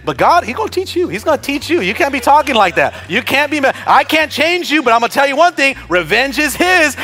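ordinary talk

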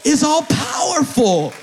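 high, loud, ringing sermon cry; full-force preaching. Real, not acted. Preacher rallying the church to believe, full-force preaching. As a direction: build the declaration to a shouted peak.

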